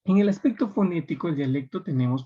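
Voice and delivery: normal talking voice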